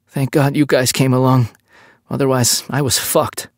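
high, distinct voice, relieved; relieved captive. Acted. Captive thanking the French police, shaky relief. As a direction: sound grateful and shaken.

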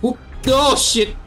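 usual speaking voice

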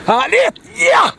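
loud, high shout of delight; real thrilled outburst; exultant cheer. Real, not acted. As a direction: cheer the catch on. Cheering the fish on.

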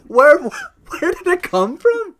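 high, loud, drawn-out disbelief; incredulous. Real, not acted. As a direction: demand an explanation, utterly baffled. Baffled by Dave's remark.

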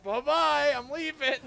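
high, loud sing-song farewell; squeaky teasing bye-bye; high mock goodbye. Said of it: "He's like".

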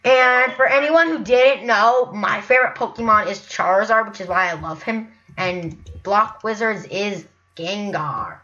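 booming announcer intro; hammed-up loud video opener. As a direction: belt it like a channel intro. Loud intro bit, far timbre.

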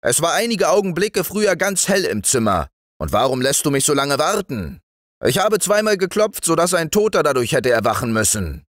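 high, fast scolding husband; indignant reproach. A narrator's reading. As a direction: complain heatedly about being kept waiting. Angry husband reproaches his wife. (aufgebrachter, vorwurfsvoller Ehemann)

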